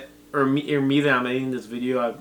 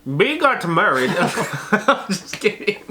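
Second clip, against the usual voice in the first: laughing; 4 semitones higher, slower than usual. Real, not acted. Laughing at text.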